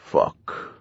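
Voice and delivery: whispered stony half-orc curse. Acted. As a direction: drop it flat and stony, under breath. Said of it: "a stony half-orc voice".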